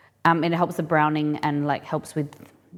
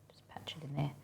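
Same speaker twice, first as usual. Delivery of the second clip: whisper; 3 semitones lower, 17 dB quieter than usual. Real, not acted. Muttering to herself while patching.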